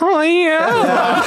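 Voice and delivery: very high, silly mimicking squeal; high-pitched voice. Rowdy crowd heckles as you're about to return, high-pitched mock voice. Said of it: "in that high-pitched voice".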